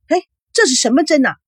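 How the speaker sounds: high, startled query; mother's astonished protest; alarmed question. A narrator's reading. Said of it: "叶枫惊讶地看着护士".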